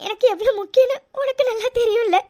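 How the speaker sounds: thanking through tears, voice breaking; grateful sobbing; crying. Acted. Thanking her husband for saving the chain, while crying. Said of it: "என்ன இது இப்படி அழுதுட்டு இருக்கா".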